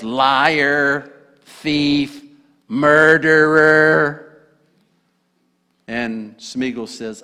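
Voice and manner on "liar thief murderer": slow, drawn-out hissing accusations; Gollum accusing. Acted. Gollum accusing Smeagol, slow and venomous. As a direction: spit each word out slowly, as Gollum.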